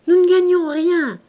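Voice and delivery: high, loud complaint; plaintive protest. A narrator's reading. Innkeeper pleading poverty to a customer, plaintive.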